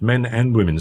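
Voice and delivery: usual speaking voice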